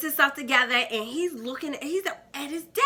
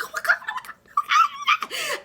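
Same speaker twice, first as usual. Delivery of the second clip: much higher squeal of oh my God, slowing at the end; excited like a kid. Retold or imitated. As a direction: squeal it like a thrilled little kid. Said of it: "I was all excited like a little kid".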